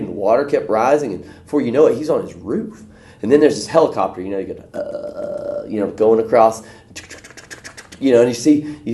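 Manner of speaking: usual speaking voice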